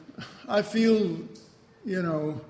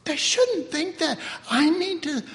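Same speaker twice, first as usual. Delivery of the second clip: mock indignation; 6 semitones higher than usual. Mock indignant past self.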